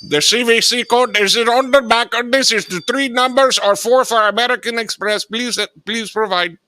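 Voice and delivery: squeaky eager phone supervisor; high put-on supervisor voice. Supervisor taking over the call, high put-on voice.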